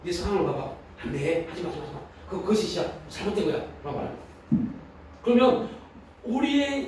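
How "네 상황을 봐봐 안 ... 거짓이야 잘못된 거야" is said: lightly acted tempter, softer voice; Satan. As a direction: talk them out of it, quietly. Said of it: "사단 말합니다". (사탄이 꼬드기듯 작게)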